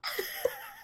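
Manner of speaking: squeaky burst of laughter; high squeal laugh. Real, not acted.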